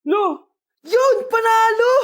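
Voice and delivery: high, loud victory cheer; triumphant yell. Acted. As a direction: celebrate the win at full voice. Winning the game. (pasigaw na pagdiriwang)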